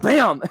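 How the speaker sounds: imitated bam. Player imitating a head hitting things, bam sound. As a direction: punch out a mock impact noise. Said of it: "it makes a bam sound".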